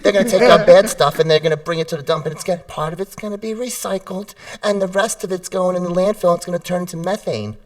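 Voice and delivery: high, loud chirpy explaining; an owner explaining recycling to the dog, high and chirpy; over-explaining owner. Retold or imitated. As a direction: ramble on brightly to the dog. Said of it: "we tell them what the garbage truck is doing".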